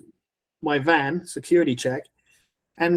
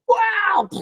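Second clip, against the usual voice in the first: much higher astonished "Wow"; amazed wow. Retold or imitated. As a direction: let the wow fly up, mind blown. Mind blown by stranger.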